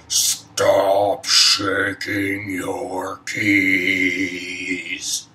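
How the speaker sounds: loud, long drawn-out yell; a booming complaint bellowed through a door; neighbour's shout. Acted. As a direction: yell it slowly, stretching every word.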